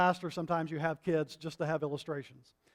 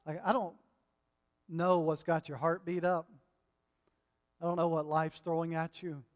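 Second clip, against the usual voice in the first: ordinary talk, nothing marked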